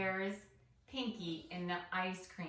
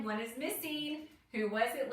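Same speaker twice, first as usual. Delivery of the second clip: ordinary delivery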